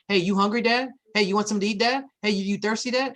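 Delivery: put-on sweet-talking dad like doting kids; kids fussing over dad. Kids fussing over their busy dad, eager and high. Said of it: "And they go".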